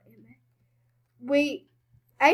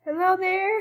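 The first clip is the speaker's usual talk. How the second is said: ordinary talk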